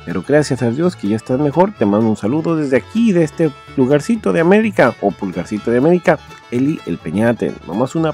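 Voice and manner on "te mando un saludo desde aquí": ordinary talk, nothing marked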